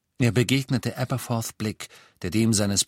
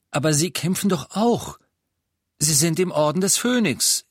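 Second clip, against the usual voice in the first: höher und lauter angreifend; Harry, herausfordernd; 8 semitones higher, 5 dB louder than usual. Acted. Harry goes on offensive. (trotziger Junge)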